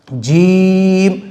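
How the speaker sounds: जीम stretched very long; drawn out. Shown as a demonstration. Teacher stretches the letter for students, very slow. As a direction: hold जीम out slowly. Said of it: "इसको भी हम खींच के पढ़ेंगे".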